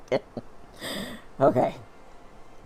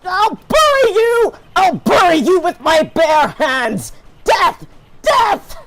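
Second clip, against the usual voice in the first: screaming, much higher and louder; villain rage; 10 semitones higher, 7 dB louder, slower than usual. Acted. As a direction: rage like an unhinged prosecutor. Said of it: "sorry about y'all's ears".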